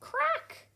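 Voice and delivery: sharp, very high crack; egg cracking sound. A narrator's reading. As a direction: snap it out like a shell breaking. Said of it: "egg hatching".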